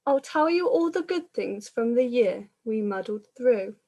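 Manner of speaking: usual speaking voice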